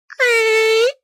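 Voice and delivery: high drawn-out creak noise; a long squeaky creak, loudly; creaking floorboard. Acted. Narrator voices creaking floor.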